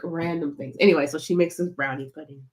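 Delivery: usual speaking voice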